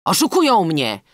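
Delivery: alarmed shout from a bandit. A narrator's reading. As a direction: cry out as if betrayed. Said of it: "krzyknął bandyta".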